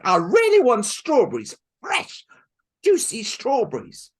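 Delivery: high, loud whiny pleading; spoiled girl demanding. Acted. Helen begging her stepmother for strawberries, loud whine. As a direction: drag it out like a spoiled brat.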